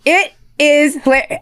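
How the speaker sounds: ordinary talk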